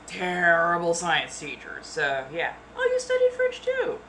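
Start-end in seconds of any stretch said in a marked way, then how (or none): 2.8-4.0 high put-on voice.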